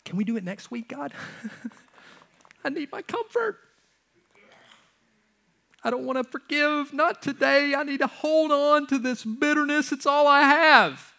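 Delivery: put-on high, pleading, childish whine; whiny reluctant sinner. Sinner bargaining with God, high mock whine. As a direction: beg to keep the grudge.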